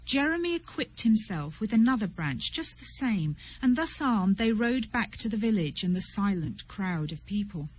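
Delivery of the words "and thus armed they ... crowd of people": usual speaking voice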